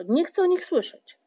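high, loud, cross refusal; hot-tempered, high-pitched protest; impetuous. A narrator's reading. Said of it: "przerwał Paszczak porywczo".

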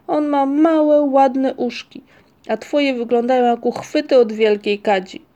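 high, loud taunting comparison; acted childish contempt; scornful. A narrator's reading. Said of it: "z pogardą".

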